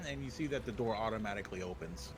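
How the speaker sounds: normal talking voice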